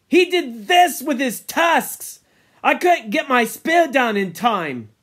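high, emphatic protest; loud self-defence. Acted. Jack showing his wound to the boys, loud protest. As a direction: protest it forcefully.

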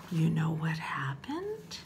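low, secretive whisper; hushed whisper to draw children in; suspenseful whisper. Acted. Storyteller builds suspense.

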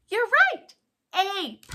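high and loud "You're right"; praising cheer. Acted. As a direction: cheer the child's answer. Teacher praising pretend answer.

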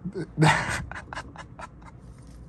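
breathy, voiceless laugh of disbelief; overwhelmed laugh. Real, not acted. Card opener who just got a huge hit, overwhelmed laugh. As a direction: laugh helplessly at your luck.

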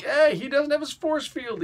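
high, put-on shaman cry of alarm. Recapping the comic, the shaman calls out in a high put-on voice. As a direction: voice the shaman spotting the danger.

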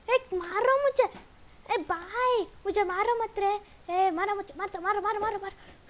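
high, urgent cries; panicked pleading. Real, not acted. Player being attacked by game mobs, panicked. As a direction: plead frantically while under attack.

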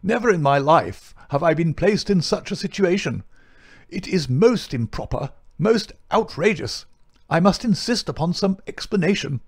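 high-pitched pompous indignation; puffed-up anger. A narrator's reading. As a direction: protest in outrage, pitch climbing high. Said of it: "swelled and puffed in his anger".

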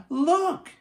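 high excited cry of delight; cried out, laughing. A narrator's reading. Annie calling to Jack as she bounds past, delighted shout. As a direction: shout it with giddy joy. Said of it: "Annie cried, laughing".

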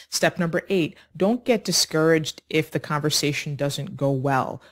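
normal talking voice

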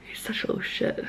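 soft whispered insult; whispered aside. Real, not acted. Owner whispering fondly about her cheeky dog. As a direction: whisper it under your breath.

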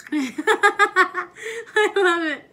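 loud, drawn-out laugh. Real, not acted. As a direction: laugh through the words. Mother laughs loudly.

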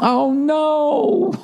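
a light giggling gasp, like the wife; laughing oh no. Retold or imitated. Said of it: "she laughed and went".

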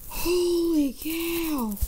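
slow, high stretched exclamation; high slow wonder; drawn-out amazement. Real, not acted. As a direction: draw it out in awe.

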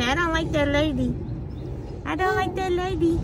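put-on high pouty voice for the baby; voicing baby. Mother voicing her baby's grudge against the nurse, high and pouty. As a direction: speak for the baby, pouting about the nurse.

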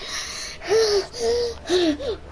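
high dramatic gasp with a laugh in it; high mock gasp. Gamer mocking his whispering teammate with a gasp.

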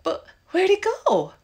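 high loud frightened cries; panicked. Acted. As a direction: panic as he is gone. Clown vanished, panic.

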